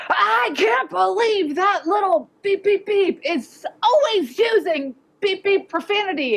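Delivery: high, slow, outraged parent voice. Retold or imitated. Parent venting in the principal's office, high and outraged. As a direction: mimic an indignant parent griping about a kid's swearing.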